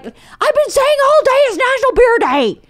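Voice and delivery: very high, loud mock tantrum; hammed-up shrieky complaint; outraged husband. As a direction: imitate a petulant yell.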